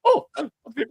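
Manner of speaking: high-pitched perky little lilt; perky accent demo. Shown as a demonstration. Showing co-hosts the different accent, perky and high. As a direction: start way up high, with a perky bounce. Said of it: "have the little perkiness to it".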